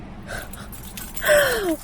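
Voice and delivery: high startled gasp of surprise; quick high-pitched gasp; sharp gasp. Real, not acted. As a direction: catch your breath in sudden shock. Sudden surprise while filming.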